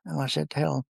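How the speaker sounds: normal talking voice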